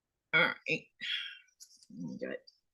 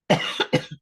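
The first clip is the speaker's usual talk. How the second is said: choking cough. Real, not acted. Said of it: "That went down the wrong way".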